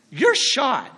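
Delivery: ordinary delivery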